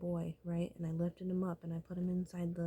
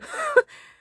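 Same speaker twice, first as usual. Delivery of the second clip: sudden loud, high-pitched laugh; burst of laughter; 18 dB louder than usual. Real, not acted. Storyteller cracking up after telling of the hit, high loud laugh.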